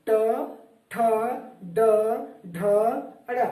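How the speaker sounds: ट, ठ, ड, ढ, अड़ each said alone with long pauses; sounding out letters. Shown as a demonstration. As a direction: show the class where the tongue touches the palate on ट, ठ, ड, ढ, अड़. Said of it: "उच्चारण करके देख लीजिए".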